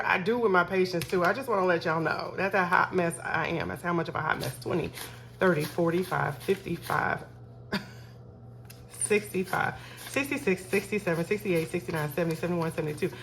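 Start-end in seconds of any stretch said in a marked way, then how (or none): none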